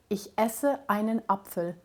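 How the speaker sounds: "Ich esse einen Apfel" with each vowel-initial word cut off by a catch; German glottal stops. Shown as a demonstration. Linguist demonstrating German, abrupt onsets in "Ich esse einen Apfel". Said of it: "there's three glottal stop there".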